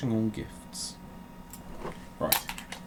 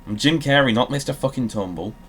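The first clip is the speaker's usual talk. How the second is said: loud mock rant; 4 semitones higher, 8 dB louder than usual. Shouting Jim Carrey Grinch line.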